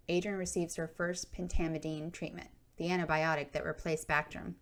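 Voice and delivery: plain reading voice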